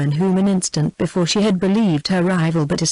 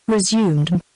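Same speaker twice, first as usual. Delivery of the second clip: plain reading voice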